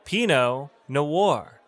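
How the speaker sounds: Pinot Noir slowly, PEE-no... no-WAR; slow pronunciation. Shown as a demonstration. Teacher breaks down a wine name, slow pronunciation. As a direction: split Pinot Noir into two careful words. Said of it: "That's how you pronounce".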